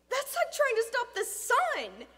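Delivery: high, loud defensive exclamation; incredulous protest. Acted. Boy defending himself to friend's father, incredulous. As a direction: protest at the impossible, pitch jumping up.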